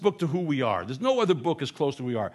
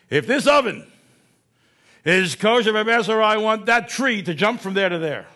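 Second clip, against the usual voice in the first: louder and higher, a bold dare; defiant challenge; 7 semitones higher, 7 dB louder than usual. Retold or imitated. The fellow daring the rabbis with a miracle, loud and defiant. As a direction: throw down the gauntlet, loudly. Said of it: "you know what he says?".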